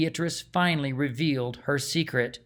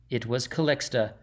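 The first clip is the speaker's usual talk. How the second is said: ordinary delivery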